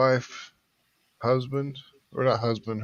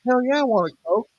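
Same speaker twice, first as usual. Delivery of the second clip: eager self; 9 semitones higher, faster than usual. Retold or imitated. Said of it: "he's like".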